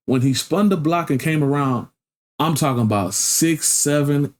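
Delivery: ordinary delivery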